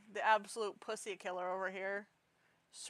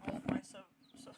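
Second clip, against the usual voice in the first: normal talking voice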